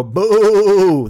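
loud, high wavering boo; booing in a sheep's bleat. Acted.

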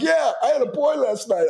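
high, giddy new-dad voice; goofy excitement. Retold or imitated. New father telling well-wishers about his son, goofy excitement. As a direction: blurt it out, head spinning. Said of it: "I'm, like, goofy".

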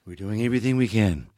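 lightly imitated Reagan, pleased to have his line; Ronald Reagan. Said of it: "he goes".

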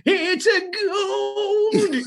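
very high, drawn-out gold-mad cry; crazed old prospector. Acted. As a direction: stretch it out like an insane prospector. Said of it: "go slowly insane and then become the".

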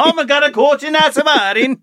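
high, exaggerated Danish accent; mock Danish lilt, pitched right up. Teasing a friend whose accent drifted to Denmark, mock Danish accent. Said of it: "you're going to, like, Denmark now".

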